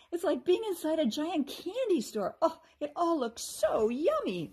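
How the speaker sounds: put-on excited child voice; delighted. A narrator's reading. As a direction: swoon over the candy. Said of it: "Rachel agreed".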